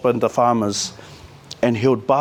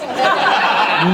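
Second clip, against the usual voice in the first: laughter; 7 semitones higher than usual. Real, not acted. Laughing before admitting he has no money. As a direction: let out a big laugh. Laughing at his own answer.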